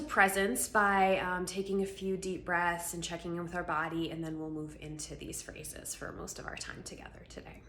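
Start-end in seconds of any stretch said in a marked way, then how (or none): none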